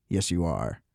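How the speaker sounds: very low, soft deadpan reply; hushed, mock-docile answer; calm mock agreement. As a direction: imitate a suspect placidly agreeing. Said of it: "like, a very calm".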